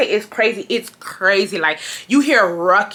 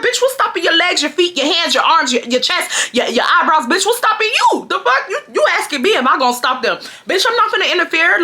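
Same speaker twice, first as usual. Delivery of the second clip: sassy retort; 7 semitones higher than usual. Retold or imitated. Her retort to the customers.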